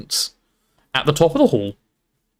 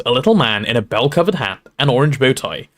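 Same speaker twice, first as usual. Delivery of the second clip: ordinary delivery, nothing marked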